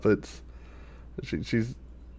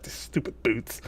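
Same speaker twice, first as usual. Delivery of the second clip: muttered whisper; 13 dB quieter than usual. Retold or imitated. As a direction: whisper it under your breath, annoyed. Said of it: "you're like".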